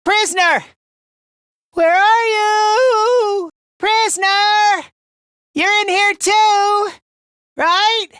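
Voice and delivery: high, drawn-out shouts; calling out desperately. Acted. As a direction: cry out for the missing prisoner. Calling out for the prisoner.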